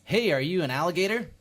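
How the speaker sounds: lightly acted cheeky stranger; mock friendly question. Stranger asking a crocodile if it's an alligator, loud questioning call. Imagined person asking an animal.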